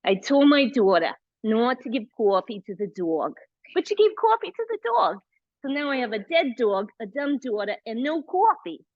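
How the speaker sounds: hammed-up New Jersey accent. Shown as a demonstration. Yoga teacher showing off a New Jersey accent as a joke, hammed-up. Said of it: "That's really more of a New Jersey accent".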